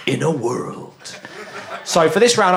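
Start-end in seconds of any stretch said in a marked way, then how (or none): 0.1-1.2 slow, low trailer announcer; movie trailer voice. Shown as a demonstration. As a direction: growl it like a film trailer. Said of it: "use the movie trailer voice".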